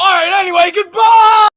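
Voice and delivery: loud high yelled goodbye; shouted goodbye. Acted. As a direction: scream the farewell. Loud sign-off.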